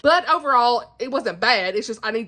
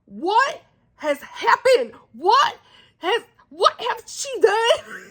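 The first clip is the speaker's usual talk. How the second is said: slow, high, crying "what has she done?"; wailing at the author over the ending; anguished disbelief; 9 semitones higher, slower than usual. Real, not acted. Vlogger just finished the book, high anguished cry.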